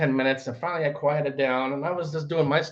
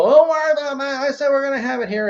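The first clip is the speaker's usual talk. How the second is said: higher, louder outburst imitating an arguing man; retold arguing; 9 semitones higher, 6 dB louder than usual. Tenant protesting to the landlord on the stairs, mock arguing. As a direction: act out his mock argumentative protest. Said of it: "he's arguing with me about it".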